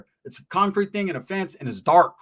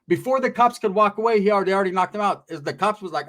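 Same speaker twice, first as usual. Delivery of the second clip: normal talking voice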